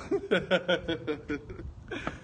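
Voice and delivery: low rolling chuckle; real laughter. Real, not acted. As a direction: crack up in a run of chuckles. Laughs at gums being eaten up.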